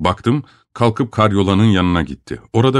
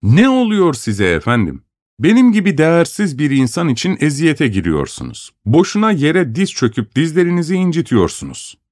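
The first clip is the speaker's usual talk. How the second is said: higher, airs of an important man; mock pompous; 7 semitones higher than usual. A narrator's reading. Narrator to Yemelyan, mock grand and haughty. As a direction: put on lofty airs with sarcasm. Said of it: "önemli bir kişiymiş gibi konuşmaya başlamıştım".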